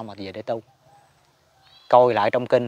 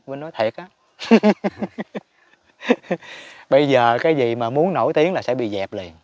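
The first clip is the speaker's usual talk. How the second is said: normal talking voice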